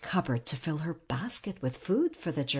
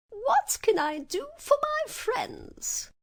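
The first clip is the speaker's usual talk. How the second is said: very high, slow squeak; tiny royal mouse voice; squeaky mouse queen; slower than usual. A narrator's reading. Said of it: "her squeaky little voice".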